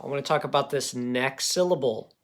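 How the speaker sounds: ordinary talk, nothing marked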